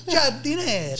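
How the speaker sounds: giardiniera said the Italian way, high and drawn out; Italian pronunciation. Shown as a demonstration. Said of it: "But you gotta d".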